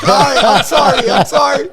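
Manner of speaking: high, loud sorry through laughter; laughing apology. Retold or imitated. Son apologizing to his angry dad, laughing hard. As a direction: apologize while cracking up. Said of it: "I'm like".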